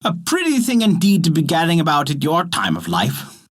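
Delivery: put-on high-pitched scolding old dame; angry scolding. A narrator's reading. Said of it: "the dame grew very angry and began scolding him".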